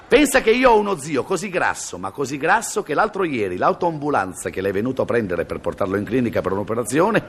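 ordinary talk

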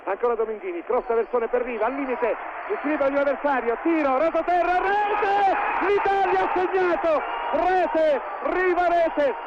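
breathless italian goal commentary. Acted. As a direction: build to a loud ecstatic goal cry. Goal call builds and peaks.

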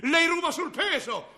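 loud, high accusing yell; angry customer's shout; shouted accusation. Acted. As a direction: hurl the accusation at him. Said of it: "mi gridò la cliente".

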